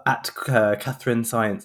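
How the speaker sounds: normal talking voice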